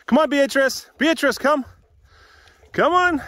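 high, loud call to the dogs; yelling. Real, not acted. Calling puppies to come along on a walk, yelling high. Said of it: "Sorry for yelling".

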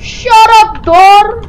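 high startled yell; screaming in fear. Real, not acted. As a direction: yell at the sudden noise. Startled by door in game.

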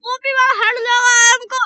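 high, loud urgent plea; panicking gamer. Real, not acted. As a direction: shout for help, panicked. Downed, begging for revive.

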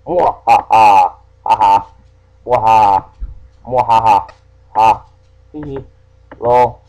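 loud mwahaha villain laughter; hammed-up evil laugh; evil cackle. Evil laugh after revealing secret.

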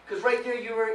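normal talking voice

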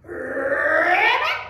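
loud rolled ribbit, rising; pig's frog croak. A narrator's reading. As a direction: croak it with a long trilled R. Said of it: "all the little pig said was".

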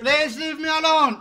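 high, very loud outburst; real scream. Real, not acted. Man screaming at quarrelling neighbours to leave him alone. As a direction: scream it at them, fed up. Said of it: "I'm sorry for the screams".

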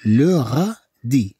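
"لو غادي" stretched syllable by syllable; slow pronunciation model. Shown as a demonstration. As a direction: linger on every syllable of "لو غادي". Slow take for learners.

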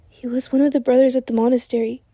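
ordinary delivery

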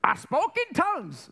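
high, drawn-out mock testimony; boastful church member. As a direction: crow it slowly, parodying a proud believer.

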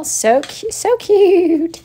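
high cooing over something cute; squeaky, adoring coo; high-pitched gushing. Real, not acted. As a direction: gush in a high voice, stretching "cute".

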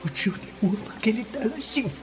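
high, breathy, heartbroken voice; tearful confession. Acted. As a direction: confess hopeless love between gasping breaths. (带着哭腔伤心告白)

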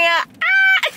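high excited shriek; excited squeal. Real, not acted. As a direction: let out a gleeful shriek. Excited cry after thumbnail.

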